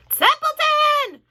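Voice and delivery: high, loud, drawn-out scream. A narrator's reading. Wilbur screaming at the sleeping rat, high and loud. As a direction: scream his name to wake him. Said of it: "screamed Wilbur".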